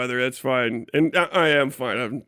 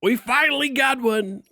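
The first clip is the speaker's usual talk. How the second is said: higher, hammed-up glee at the badge; mock triumph; 8 semitones higher than usual. Seeing the FBI badge at his door, mock triumphant cry. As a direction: crow it like you finally got caught. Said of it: "and I'm like".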